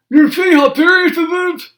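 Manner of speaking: high, loud toothless moan; Grandpa moaning. Acted. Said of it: "moaned Grandpa".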